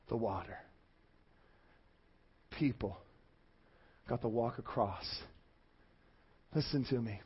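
low, very soft, with long pauses; hushed solemn preaching. Real, not acted. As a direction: let each phrase sink in, almost privately. Hushed, halting close of sermon.

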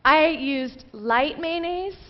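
high, drawn-out, rising admission; sheepish confession. Retold or imitated. Wife admitting the wrong ingredient, mock sheepish. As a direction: confess it slowly as if asking a question.

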